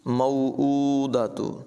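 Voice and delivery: मौऊदतु with the hamza vowel drawn long; lengthened hamza. Shown as a demonstration. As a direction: stretch the ऊ in मौऊदतु. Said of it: "इसे लंबा करके पढ़ेंगे". (मौऊदतु में हमज़ा लंबा करके)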